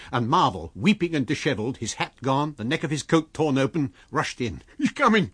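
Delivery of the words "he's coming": high, loud shriek of terror; terrified shriek. A narrator's reading. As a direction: shriek it in panic. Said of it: "his voice shrieking with terror".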